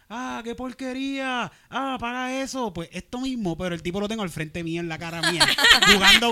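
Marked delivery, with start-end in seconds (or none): 0.1-2.7 high, slow mock heckler jeer. Comedian mimics a heckler yelling from inside, high and slow. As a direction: jeer like a drunk heckler, half-hearted. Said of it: "la gente gritó desde adentro".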